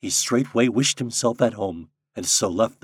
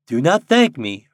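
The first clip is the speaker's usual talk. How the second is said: a firmer, louder reply; slightly raised Nuke character voice; lightly voiced Nuke; 5 semitones higher, 9 dB louder than usual. A narrator's reading. Popopo answering the birds, firmly. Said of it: "returned the Nuke".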